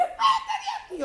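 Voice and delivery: a demon in the mirror screaming at her to leave; furious demon. Retold or imitated. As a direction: shriek it like an enraged demon cackling. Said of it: "Y está muy furioso el demonio".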